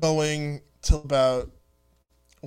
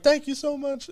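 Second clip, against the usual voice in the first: higher-pitched grateful gush; imitated excited kid; 8 semitones higher than usual. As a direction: lightly voice a thrilled young fan. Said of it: "he was so excited".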